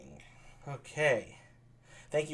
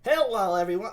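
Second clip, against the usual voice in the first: a lightly voiced boy, pitched higher and louder; young boy voice; 7 semitones higher, 4 dB louder than usual. Claudio greeting everyone, lightly voiced young boy. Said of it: "give him the young boy voice".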